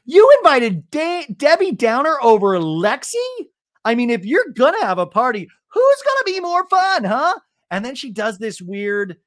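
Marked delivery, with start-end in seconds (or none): 0.0-7.4 high, loud, teasing impression; mocking Alexis. Alexis taunting Tamara about the party, high mocking voice. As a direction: needle her in a shrill-high party-girl voice. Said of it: "Alexis goes".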